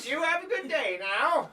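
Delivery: put-on high-pitched old orc farewell; elderly orc. GM as the old man waving off the bikers, high-voiced.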